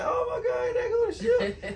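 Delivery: high laughing squeal of excitement; excited shout. Retold or imitated. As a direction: shriek with delight at seeing the ship. Retelling wife's excitement at the ship.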